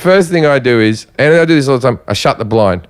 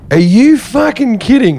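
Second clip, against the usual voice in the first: raised, incredulous retort without shouting; indignant disbelief at a higher pitch; restrained retold outrage; 6 semitones higher than usual. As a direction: re-enact the outburst, held back from a yell. Repeats outburst after dogs were set off.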